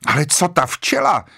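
high, insistent exclamation; crying out. A narrator's reading. Vera protests about the bee, crying out high. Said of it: "křičela Vera".

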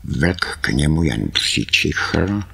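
plain reading voice

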